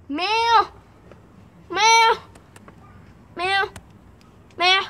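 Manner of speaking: high-pitched pretend kitty; cat meow. Imitates the cat.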